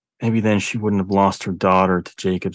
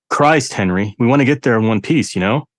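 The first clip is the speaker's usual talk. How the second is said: exasperated outburst; 5 semitones higher, 5 dB louder than usual. A narrator's reading. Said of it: "Jerry sat back in a huff".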